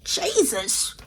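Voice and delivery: lightly exasperated British telling-off; British accent coming out. Retold or imitated. To [name] about socks, mild British telling-off. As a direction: scold him for bare feet, British style. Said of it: "I get so angry when the accent come out".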